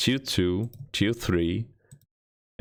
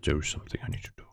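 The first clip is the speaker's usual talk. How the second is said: "There is something I need to do" whispered fast and lower; 7 semitones lower, 6 dB quieter, much faster than usual. Acted. Streamer whispering the secret plan to chat. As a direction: hush it to a secret.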